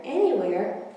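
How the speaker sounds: ordinary delivery, nothing marked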